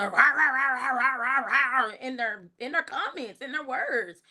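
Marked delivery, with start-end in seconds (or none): 0.0-2.4 "rah rah rah" as mock grumbling; imitated griping. Host imitating the bitterness she hears in comments, mock griping. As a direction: mimic bitter commenters with a run of rahs. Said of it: "I could just hear the".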